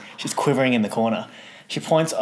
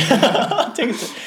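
high laughing voice; 13 semitones higher, 3 dB louder than usual. Retold or imitated. Asking the manager for soju, laughing. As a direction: laugh through it.

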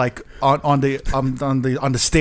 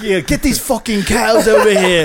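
mock British boss, higher-pitched order; British accent; 7 semitones higher, 4 dB louder than usual. British club owner orders the dancers moved, mock British accent. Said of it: "You have to do the accent".

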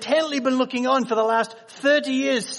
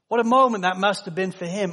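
usual speaking voice

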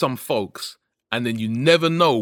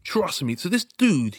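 ordinary talk, nothing marked

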